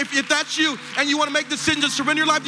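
high-pitched, urgent preaching; impassioned altar call. Real, not acted. Pastor urging new believers to walk to the front, impassioned preaching. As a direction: preach at full force, calling them forward.

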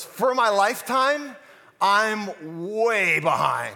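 put-on slow, drawn-out rueful old lady; older lady quote. Lightly voiced old lady admitting her lotto losses, slow. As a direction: let way behind sink in very slowly. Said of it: "She goes".

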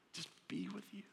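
awed whisper. Acted. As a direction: lean in and whisper it. Whispered wonder at God.

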